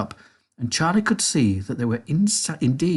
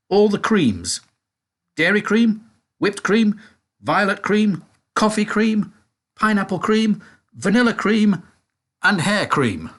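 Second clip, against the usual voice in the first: high, louder showman reading of the list; announcing sign; 9 semitones higher, 4 dB louder than usual. A narrator's reading. Narrator reads the storeroom door sign, high and bright. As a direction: rattle off the sign like a delighted showman.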